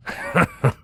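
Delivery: short amused guard's chuckle. A narrator's reading. Accented guard chuckling at his grumbling colleague. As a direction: give a quick scoffing chuckle. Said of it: "The accent chuckled".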